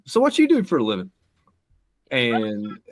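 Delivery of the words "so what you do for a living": high, loud naive question. Retold or imitated. Uncle asking [name] his job, high and loud. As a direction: innocently ask the star his job. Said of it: "looks over at [name] and goes".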